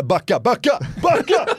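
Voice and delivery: high, loud urgent shout to back off. Retold or imitated. Zlatan tells swarming kids to back off, shouting. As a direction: push the crowd back with your voice.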